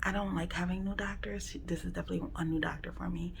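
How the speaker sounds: nervous whisper; hushed and uneasy. Real, not acted. Quiet waiting room, nervous.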